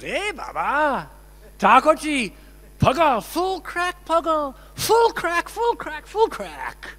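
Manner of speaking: high, loud mock-indignant outburst; 呆れて叱る声. Acts out babaji scolding his deity.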